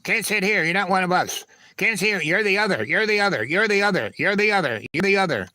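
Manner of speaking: high-pitched sing-song schoolyard taunt; mock-bullying chant, pitched high. Mimicking taunting kids at lunch.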